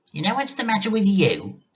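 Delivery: grandma demands tea from George, bossy and cranky. Acted. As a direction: order the boy about like a cranky old lady. Grandma voiced in story.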